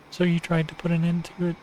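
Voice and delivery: lightly voiced cooing yandere girl; Nagi voice. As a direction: coax him, sweetly cruel. Nagi voice continues.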